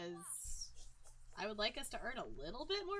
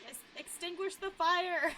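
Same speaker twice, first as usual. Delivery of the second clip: urgent shout; 6 semitones higher, 7 dB louder than usual. Real, not acted. Urging Sim to act.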